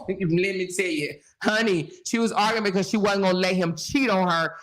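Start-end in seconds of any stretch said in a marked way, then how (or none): none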